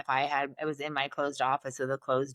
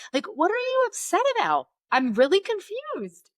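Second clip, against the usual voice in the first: mock confusion; 4 dB louder than usual. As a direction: play up being baffled to make a point. Her retold reply to the complaining tech.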